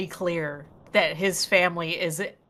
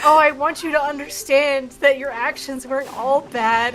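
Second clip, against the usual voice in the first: higher, louder syrupy mock sincerity; mock earnest Ivy; 7 semitones higher, 7 dB louder than usual. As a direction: preach it like a sappy life lesson. Mimicking Ivy's earnest speech.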